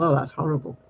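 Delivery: ordinary talk, nothing marked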